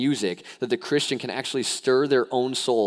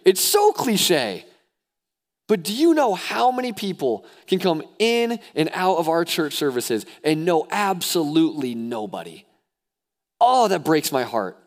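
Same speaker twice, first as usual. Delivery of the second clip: high, loud preacher exhortation; rousing, urgent sermon delivery; impassioned preaching; 9 semitones higher, 6 dB louder than usual. Real, not acted. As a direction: preach it at full force, pitched well up. Preaching at full force.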